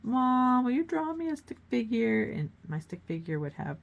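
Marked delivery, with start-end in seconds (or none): 0.0-2.2 loud, high, lightly voiced child begging Mom; pleading child. Young daughter begs her mom to draw a stick figure. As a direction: ask Mom like an eager little kid. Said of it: "I would be like".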